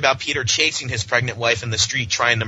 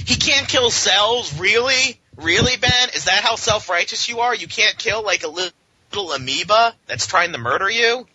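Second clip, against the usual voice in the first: incredulous mockery; 9 semitones higher than usual. Mocking Ben's self-righteousness.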